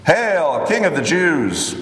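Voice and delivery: high-pitched, proclaimed mock salute; mild mocking acclamation, falling at the end; mocking hail. A narrator's reading. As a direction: hail him with understated mockery. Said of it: "knelt before him and mocked him".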